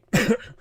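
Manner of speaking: sharp choking cough; sudden cough. Real, not acted. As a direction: cough on a tickle in the throat. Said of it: "I'm choking".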